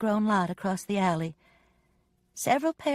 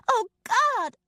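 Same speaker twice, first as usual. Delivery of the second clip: high, loud cry of horror; scream; 4 dB louder than usual. A narrator's reading. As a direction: scream it out in shock. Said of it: "I screamed".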